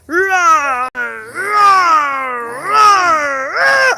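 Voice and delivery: hammed-up tiger rah for a toddler; mock roaring tiger game; playful tiger roar. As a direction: roar like a friendly pretend tiger. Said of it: "You act like a tiger".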